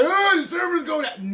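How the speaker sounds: high, whiny voice mocking the rumour; high mocking whine. Mocking the people spreading server rumours, in a high whiny voice. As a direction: squeak it out like a silly gossip. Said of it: "stop running around saying".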